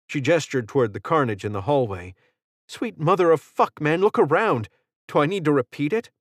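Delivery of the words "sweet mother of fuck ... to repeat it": higher, fast incredulous retort; exasperated snap. Acted. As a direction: throw it back at him, fed up. Exasperated answer amid the carnage.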